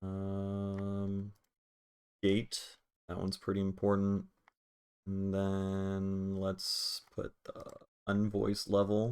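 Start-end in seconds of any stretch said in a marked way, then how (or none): none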